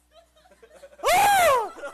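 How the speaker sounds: loud laughter. Real, not acted. Audience roars at punchline.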